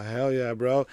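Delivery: usual speaking voice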